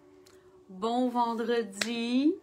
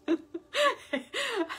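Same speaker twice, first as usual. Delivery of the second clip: high delighted laugh; 13 semitones higher than usual. Real, not acted. Laughs at end of anecdote.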